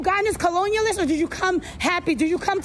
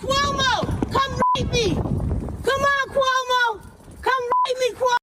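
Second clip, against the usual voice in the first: higher, sing-song taunting calls; mocking call-out; 4 semitones higher, much slower than usual. Interviewer heckling Cuomo from the street, higher taunting calls. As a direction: heckle in a raised chant.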